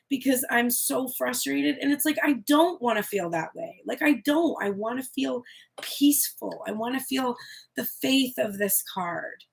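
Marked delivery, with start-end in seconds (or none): none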